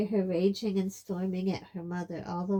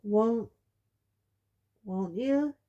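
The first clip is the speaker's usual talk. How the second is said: uncertainly; 4 semitones higher, 7 dB louder, slower than usual. A narrator's reading. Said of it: "he added uncertainly".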